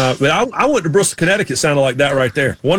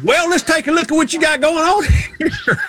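put-on Southern accent; 14 semitones higher than usual. Surgeon greeting a patient, exaggerated high Southern accent. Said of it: "have a Southern accent".

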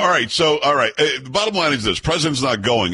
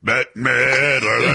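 drawn-out name; much slower than usual. Retold or imitated. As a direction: drag the name out teasingly. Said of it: "sounds like you're saying [name]".

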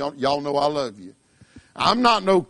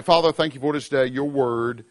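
prayer; 5 semitones lower than usual. Acted. Closing prayer.

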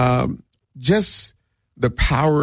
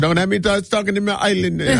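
put-on Jamaican accent; 9 semitones higher, faster than usual. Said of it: "when I try to do Jamaican accents".

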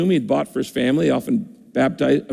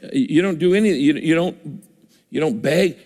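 usual speaking voice

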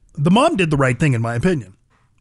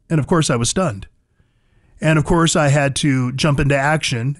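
usual speaking voice

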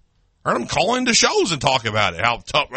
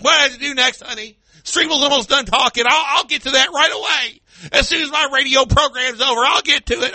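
high, meek husband answering wife; mock henpecked husband; 9 semitones higher than usual. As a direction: play the timid husband to his wife. Mocking a husband answering his wife.

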